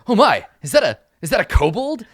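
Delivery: high, loud theatrical astonishment; startled knight. Acted. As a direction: start in alarm at an unexpected sight. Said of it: "he takes a start".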